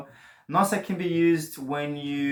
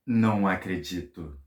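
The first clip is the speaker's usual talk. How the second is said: amazed disbelief; 4 semitones lower than usual. Shown as a demonstration. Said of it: "you can say, like, gasps".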